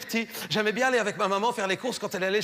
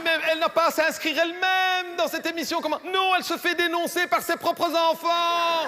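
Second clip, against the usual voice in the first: high, vehement rebuttal; worked-up explanation; 7 semitones higher than usual. Acted. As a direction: pitch it high, insisting on the outrageous truth. Réplique au directeur sur l'inscription. (explication véhémente, aiguë)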